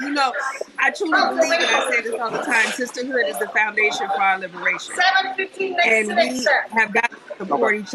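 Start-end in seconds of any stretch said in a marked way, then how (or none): none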